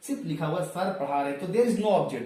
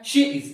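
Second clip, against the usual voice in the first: She is said much louder, with weight on is; emphatic She is; 4 semitones higher, 8 dB louder than usual. Shown as a demonstration. Teacher corrects students, stressing She is loudly. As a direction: insist on She is. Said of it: "but आपको कहना है".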